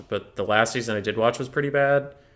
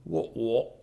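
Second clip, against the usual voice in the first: mock British accent; 5 dB quieter than usual. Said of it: "British accent".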